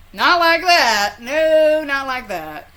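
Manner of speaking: loud, high, slow protest; no way, not like that!; emphatic denial. Real, not acted. Emphatic denial of being hillbilly.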